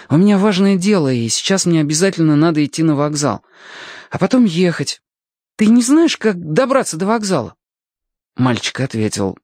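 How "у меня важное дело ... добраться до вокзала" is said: put-on raised boyish pitch; earnest boy. Alyoshka explains he must get to the station, earnest boy. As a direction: explain apologetically in a higher boy's voice.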